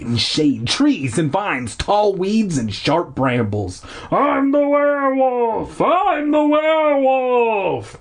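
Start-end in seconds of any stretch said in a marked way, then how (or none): 4.1-8.0 high, drawn-out manic shriek; crazed shriek. Acted. Hermit chasing the kids, high manic shriek. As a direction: shriek it like a madman. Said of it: "high-pitched wails of laughter".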